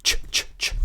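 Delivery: "Ch, ch, ch" as short unvoiced tch bursts; repeated tch. Shown as a demonstration. Teacher drilling the English tch sound alone. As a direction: spit out "Ch, ch, ch" like a tch click. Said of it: "Como se fosse T C H".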